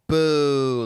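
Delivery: loud, drawn-out boo; imitated heckler's boo. Retelling a heckler booing her.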